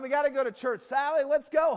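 loud, high hurry-up call; harried mom calling. Acted. Mom hurrying Sally and Johnny to church, harried calling. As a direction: holler to get the kids moving.

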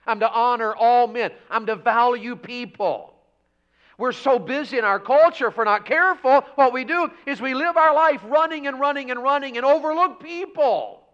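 high, loud pulpit shouting; full-force preaching. Real, not acted. Preacher charging believers to thrive in holiness, full-force preaching. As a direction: proclaim it to the whole church at full volume.

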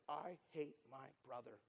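very soft, low, bitter words; retold contained anger. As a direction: imitate deep anger held down, word by word. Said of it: "looks at me with so much anger".

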